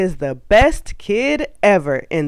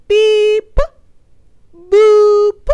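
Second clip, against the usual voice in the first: high loud beeps; 9 dB louder than usual. Acted. Silly sound words read.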